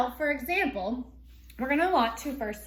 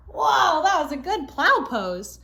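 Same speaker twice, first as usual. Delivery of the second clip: excited whoa; 3 semitones higher, 7 dB louder, slower than usual. Real, not acted. Teacher reacting to finishing pose.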